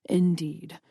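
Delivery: low, silky, menacing vampire; a hint of a silky, menacing master; silky menace. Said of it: "his voice silky and menacing".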